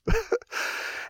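soft, airy real chuckle; high, breathy little laugh; quiet laugh. Real, not acted. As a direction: chuckle softly after the punchline. Amused by own story.

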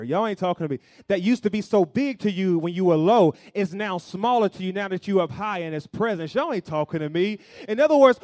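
loud, high, driving sermon delivery; full-force preaching. Real, not acted. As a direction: preach it loud, building to a peak, then easing down.

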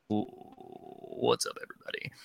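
unvoiced, soft rasp of a greeting; hungover husky voice. Co-host greeting the camera the morning after a show, husky. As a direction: croak it after a late night. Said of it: "I immediately go to the microphone and I go".

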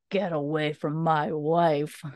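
menacing stranger's voice. Retold or imitated. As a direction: warn him off her, slow and cold. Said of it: "this voice that says".

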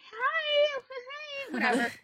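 put-on very high bubbly valley girl; airy, giggly, way up high. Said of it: "So my Valley girl".

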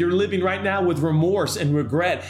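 preacher pleading with the struggling listener, impassioned; preaching at full force. Acted. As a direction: drive the altar call home with fire. Preaching climax over music.

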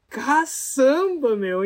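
slow, loud, astonished cry of wonder; stunned exclamation. Retold or imitated. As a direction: let the amazement burst out long. Stunned by what he saw.

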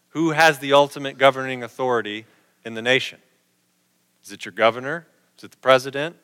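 ordinary delivery

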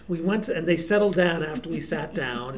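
ordinary delivery

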